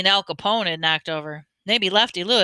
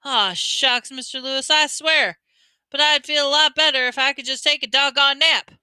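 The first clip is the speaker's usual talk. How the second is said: whiny; 6 semitones higher, 3 dB louder than usual. Acted. Boy whining reluctantly.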